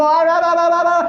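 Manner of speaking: high, rapid mock blabbering. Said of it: "mataas ang boses mo".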